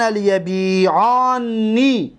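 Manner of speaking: لیبیعانی drawn out slowly with the doubled nun held; slow model word. Shown as a demonstration. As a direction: sound out لیبیعانی slowly, holding the nn. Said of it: "با نون توکید ثقیله گویی که". (لیبیعانی آهسته و کشیده)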